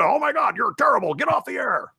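host voice fed up with the caller; outraged caller. Acted. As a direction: shoo the caller off the air. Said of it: "he'll call in".

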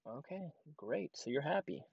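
hushed, placating aside; muted acceptance. Retold or imitated. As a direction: say it way under the voice. His muted reply to customer.

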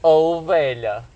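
drawn-out, slow dismay; groaning when asked for his ID card; stunned groan. Retold or imitated. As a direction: stretch it out, caught off guard.

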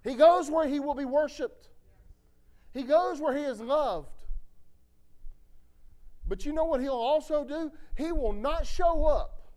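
loud, high, driving preacher's call; fiery pulpit delivery, rising and loud; preaching at full force. Acted. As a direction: preach it out to the whole room.

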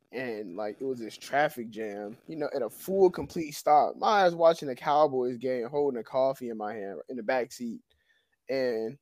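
ordinary delivery

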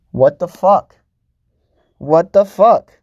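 loud, high disbelieving yell; outraged shout. Real, not acted. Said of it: "outraged at being forced".

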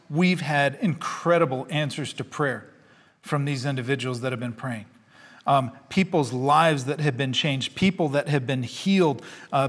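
usual speaking voice

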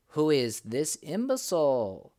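a voice from the rocks jeering out loud; crying out. A narrator's reading. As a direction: call him an imbecile in outrage. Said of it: "cried some".

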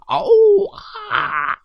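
high-pitched cartoon roar; playful storybook lion roar. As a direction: leap out with a roar. Lion leaps out roaring.

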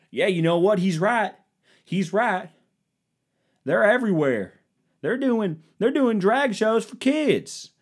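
high, indignant mocking voice; hammed-up outrage in a high voice; mock outraged conservative. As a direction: play a riled-up Facebook uncle.

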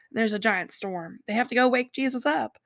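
ordinary talk, nothing marked

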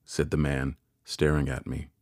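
usual speaking voice

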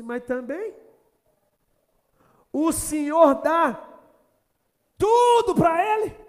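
building from a mock complaint to a high shout; the devil protests, rising to a loud cry on Tudo; devil's taunt. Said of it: "Aí o diabo falou assim". (o diabo reclamando, subindo até gritar)

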